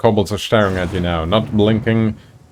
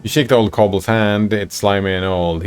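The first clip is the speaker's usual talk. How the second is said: normal talking voice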